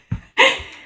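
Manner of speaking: quick breathy giggle; stifled chuckle; amused laugh. Real, not acted. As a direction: burst into a small real laugh. Amused by Nala, the dog.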